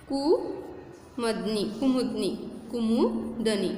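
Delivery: कुमदनी stretched out very slowly, syllable by syllable; very slow model word. Shown as a demonstration. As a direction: spell out कुमदनी slowly for the class. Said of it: "teacher drills word slowly". (कुमदनी बहुत धीरे, खींचकर)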